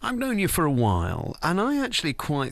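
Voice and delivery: ordinary talk, nothing marked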